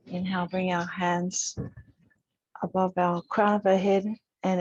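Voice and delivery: calm, unhurried instructor voice with long pauses; even, measured cues, leaving space to breathe; guided yoga meditation. Real, not acted. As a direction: gently guide a class through breath and movement. Leads breathing and gentle yoga.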